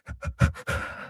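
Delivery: soft unvoiced breathy exhale of defeat; shaky imitated sigh. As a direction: breathe out like the air just left you. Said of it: "she does this thing with her breathing".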